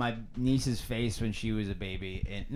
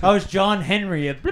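normal talking voice

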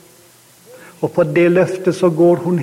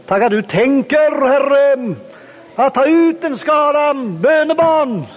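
fervent prayer; 6 semitones higher than usual. Acted. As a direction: praise prayer building to a high, ringing pitch. Leads a closing prayer of praise.

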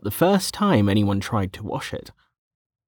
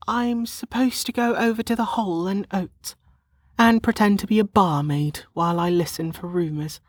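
put-on high-pitched young woman, put-upon; sighing complaint; 9 semitones higher than usual. A narrator's reading. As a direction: explain wearily in a high character voice. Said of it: "Lana sighed".

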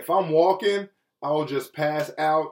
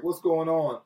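ordinary delivery

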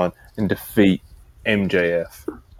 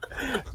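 high laugh; 15 dB quieter than usual. Real, not acted. Laughing at the impression.

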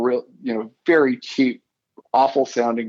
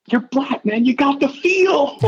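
much higher, imitating a New Orleans drawl. Professor praising his student's blues playing, in a higher New Orleans drawl. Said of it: "in his New Orleans drawl".

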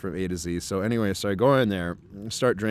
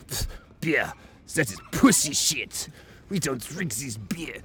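slow, scornful put-on Russian accent; 3 semitones higher, slower than usual. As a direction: sneer slowly as a tough Russian. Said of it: "stereotypically Russian guy".

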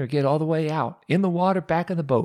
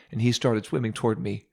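ordinary talk, nothing marked

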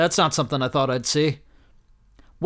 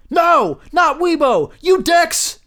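much higher, louder cry of mock despair; mock anguished shout; 12 semitones higher, 6 dB louder, slower than usual. Reading his own tweet, a hammed-up anguished shout. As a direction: wail it like your hero just fell.

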